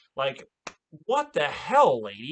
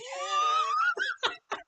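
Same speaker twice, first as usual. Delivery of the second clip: shrill burst of real laughter; laughing at the impression; high squealing laugh. Real, not acted.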